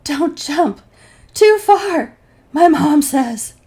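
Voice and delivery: gasping, breathless high pleading; panting out broken words; out of breath. Retold or imitated. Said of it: "gasping fragments of sentences".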